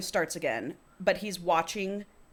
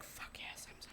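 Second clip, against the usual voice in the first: barely voiced, hushed aside; retold whisper; 23 dB quieter than usual. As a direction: whisper it as a secret thought. Retold lustful reaction while secretly watching.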